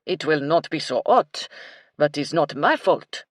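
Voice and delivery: put-on loud Frenchman's heavily accented English; French cook's thick accent, emphatic. A narrator's reading. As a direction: speak up in a strong French accent. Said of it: "in his thick French accent".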